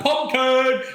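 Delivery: high-pitched mock yell; imitated yell. Telling mates about a disruptive classmate, imitated yell. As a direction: imitate a classmate's random outburst.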